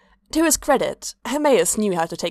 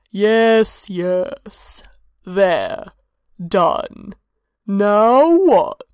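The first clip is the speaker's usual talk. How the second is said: impatient god voice; 6 dB louder, much slower than usual. A narrator's reading.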